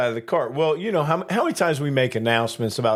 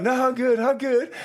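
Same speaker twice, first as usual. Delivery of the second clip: high strained mock refusal; imitating coworker; 12 semitones higher than usual. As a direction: squeak out a proud refusal while struggling.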